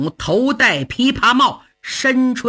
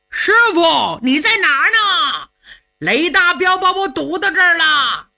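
shouting for help; 5 semitones higher than usual. Acted. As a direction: bellow up the hillside as if your life depends on it. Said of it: "所以冲着山上大喊".

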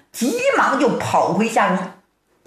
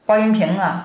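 plain reading voice

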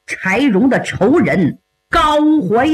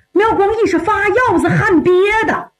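plain reading voice

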